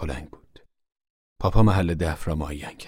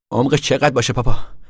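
higher, louder call up from the hole; 8 semitones higher, 8 dB louder than usual. Acted. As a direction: call up to Pa from the grave. Tom calls up from grave.

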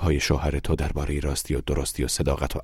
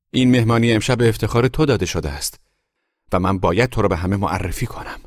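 loud, raised-pitch confrontation; public quarrel; 4 semitones higher, 6 dB louder than usual. A narrator's reading. Wife confronting her husband before guests, loud and angry. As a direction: accuse him so everyone hears.

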